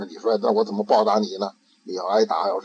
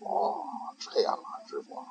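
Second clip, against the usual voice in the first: soft, slow, breathy reply; meek whisper; 9 dB quieter than usual. Acted. Ikkyu answering his master, slow and hushed. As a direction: whisper like a sly little novice.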